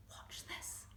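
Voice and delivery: hushed, unvoiced whisper; breathy conspiratorial whisper. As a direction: whisper it like a secret trick. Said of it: "he whispered".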